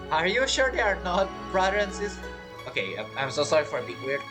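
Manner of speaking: normal talking voice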